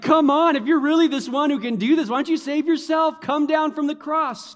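high, loud jeering at the cross; mocking taunt. Preacher voicing the crowd jeering at Jesus, loud mocking taunt. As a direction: sneer it up at him like the crowd.

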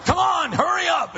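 loud, high hammed-up yell; mock impatient shout. Husband hurrying wife out of the car, hammed-up shout. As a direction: bark it like a grumpy husband.

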